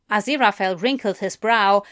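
ordinary delivery, nothing marked

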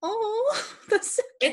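very high, gushing squeal; high fangirl squeal with a laugh. Retold or imitated. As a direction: coo over something adorable. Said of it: "I was like".

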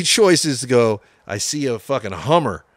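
usual speaking voice

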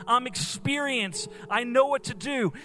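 rising, high-pitched sermon intensity; impassioned preaching. Acted. Preacher telling the church God is in their boat, impassioned preaching. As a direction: press the storm onto the listeners, building urgency. Preacher applies the storm to listeners.

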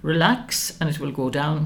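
ordinary delivery

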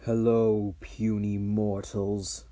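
slow, lordly archdevil voice; a hint of a scornful demon lord; dragon voice.

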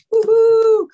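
high, loud cheering woo-hoo; excited whoop. Real, not acted. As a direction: whoop it out with joy. Cheering at the start.

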